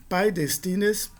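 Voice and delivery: pai destines said slowly, syllable by syllable; careful Greek demo. Shown as a demonstration. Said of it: "Outro exemplo". (pai destines pronunciado devagar)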